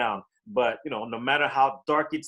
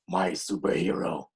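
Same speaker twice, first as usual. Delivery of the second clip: low Batman-style hero voice; superhero voice; 7 semitones lower than usual. Acted. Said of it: "in my superhero voice".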